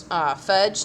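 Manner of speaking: usual speaking voice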